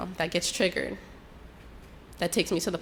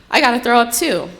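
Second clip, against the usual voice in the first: loud, raised-pitch blurt; retold urgent exclamation; urgent outburst; 5 semitones higher, 10 dB louder than usual. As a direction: blurt it out loudly, acting out the moment. Acted outburst while feeling sick.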